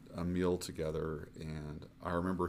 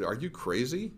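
incredulous; 6 semitones higher than usual. Retold or imitated. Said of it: "And I was terrified".

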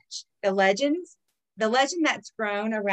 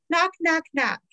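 mock knocking; 8 semitones higher, 3 dB louder than usual. Acting out knocking ritual.

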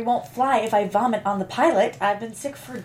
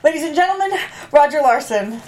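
announcing; 6 dB louder than usual. Acted. Announcing the speaker on stage.

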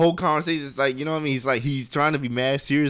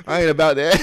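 louder mock-firm refusal; back to outward face; 4 semitones higher, 5 dB louder, faster than usual. Man disowns his private thoughts, mock-firm refusal. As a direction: brush him off with playful indignation. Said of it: "it goes back to his face".

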